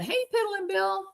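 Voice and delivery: high, mocking singsong hello; mock greeting. Mimicking a commenter greeting Bill. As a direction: lightly mimic a teasing greeting. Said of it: "Cinnamon Hot Hot said".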